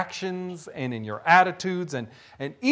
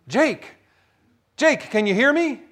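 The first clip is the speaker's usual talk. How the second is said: mildly raised calling voice, higher; 6 semitones higher than usual. Acted. Voice from above checks on Jake, calling out. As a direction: call down to someone out of sight.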